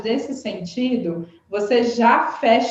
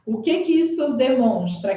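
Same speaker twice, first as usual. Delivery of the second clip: normal talking voice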